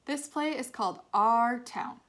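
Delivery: stage announcer. A narrator's reading. Actor opening the play on stage.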